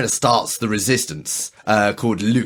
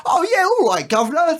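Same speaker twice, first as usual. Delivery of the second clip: much higher, hammed-up Cockney accent. Mocking English actors in American shows with an over-the-top Cockney accent. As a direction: ham up a stage Cockney geezer accent. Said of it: "the most stereotypical".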